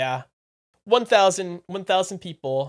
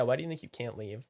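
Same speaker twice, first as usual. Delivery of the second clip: ordinary talk, nothing marked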